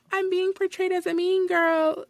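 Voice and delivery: put-on high, slow babyish complaint; mock-victim little-girl voice. Mimics the baby-voiced coworker complaining.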